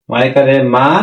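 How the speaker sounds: usual speaking voice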